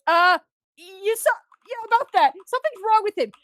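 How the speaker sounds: high, loud, halting dodge; hammed-up flustered confession; awkward admission. [name] awkwardly admits friend is sick.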